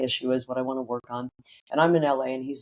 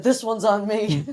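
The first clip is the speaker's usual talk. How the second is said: father's voice; 8 semitones higher, 3 dB louder, slower than usual. Retold or imitated.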